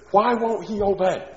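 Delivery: high, loud, exasperated parent. Retold or imitated. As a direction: vent like a fed-up parent. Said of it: "Have you ever heard parents say".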